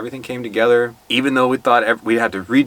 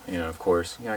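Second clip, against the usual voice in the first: usual speaking voice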